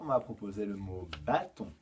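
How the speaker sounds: usual speaking voice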